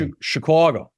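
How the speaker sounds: "Chicago" with a rounded "caw" in the middle; Royko's Chicago. Shown as a demonstration. Actor showing the host how it's said in the play, "Chicago" as "shi-KWAW-go". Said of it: "Say it like you do in the play".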